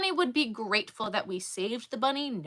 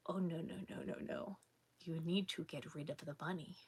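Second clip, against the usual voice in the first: hushed warning; 5 semitones lower, 17 dB quieter than usual. Retold or imitated.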